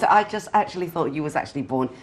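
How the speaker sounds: ordinary delivery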